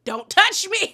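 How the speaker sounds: loud, high-pitched mock outburst; outraged warning. Recapper acting out warding off a grabbing castmate, loud outrage.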